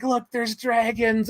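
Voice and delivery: high, drawn-out pointing cry; mock amazement. Imitating characters spotting dragons in the old days, high mock amazement. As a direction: point out a marvel, slowly and high.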